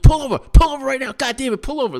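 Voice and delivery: high, loud mock scream; retold screaming. Screaming at the drivers to pull over, high and loud. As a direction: scream it at the car ahead. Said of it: "screaming and yelling at them".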